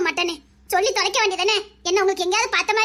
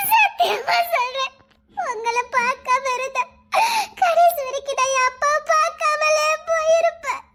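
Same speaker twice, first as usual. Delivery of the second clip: higher, slow, voice breaking into tearful laughter; crying through a laugh; 6 semitones higher, slower than usual. Acted. A woman thanking the man who reunited her with her father, crying and laughing. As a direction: sob out the thanks while laughing through tears.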